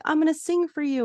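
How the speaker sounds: put-on high, sweet little-kid voice; little girl voice. Podcaster voices the little girl offering to sing. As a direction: chirp it up like a small girl.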